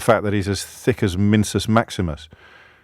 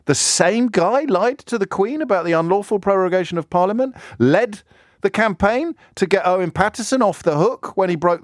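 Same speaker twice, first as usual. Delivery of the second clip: much higher, hammed-up astonished voice; mock disbelief; 10 semitones higher than usual. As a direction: feign wide-eyed shock at each charge. Sarcastic mock disbelief about minister.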